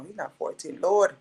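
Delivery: usual speaking voice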